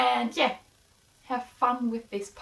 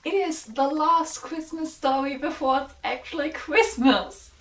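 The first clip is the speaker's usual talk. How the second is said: ordinary delivery, nothing marked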